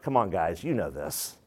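low, quiet exasperated urging; hint of weary impatience; exasperated Paul. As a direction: sigh it out like a tired teacher. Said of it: "he's like".

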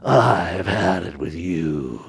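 slow, low, weary parent warning; fed-up parent voice. Retold or imitated. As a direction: drag it out like a tired parent who has had enough. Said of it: "In that voice".

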